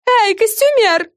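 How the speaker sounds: high, slow call out; yelling. A narrator's reading. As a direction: yell across the room. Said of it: "завопила Френ". (громкий вопль)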